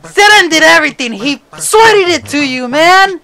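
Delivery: high, loud, indignant complaint; exasperated yelling. Real, not acted. Gamer scolding his player after a miss, loud exasperated yelling. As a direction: protest loudly at the wasted play.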